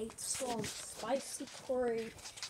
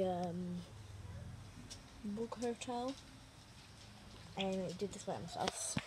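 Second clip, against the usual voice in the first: ordinary delivery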